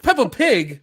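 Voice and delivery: high, loud incredulous echo. Real, not acted. Host teased about his accent by co-host, incredulous. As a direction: repeat it in offended disbelief.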